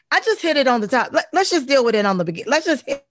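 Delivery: loud, high and fast, fired-up declaration. Real, not acted. Telling the host how she shuts critics down: loud, high and fast. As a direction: hit it hard and fast, full of sass. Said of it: "how she handles her voice".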